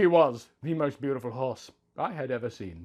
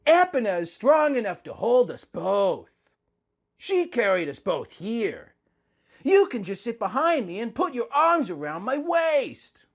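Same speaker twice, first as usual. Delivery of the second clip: a touch higher put-on voice; Link's voice; 7 semitones higher than usual. A narrator's reading. Link suggesting they share Epona, lightly voiced. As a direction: lift it for Link, gently. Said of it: "Link said".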